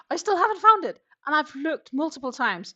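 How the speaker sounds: loud, high and exasperated. Real, not acted. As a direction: blurt it out, fed up. Exasperated about lost yarn end.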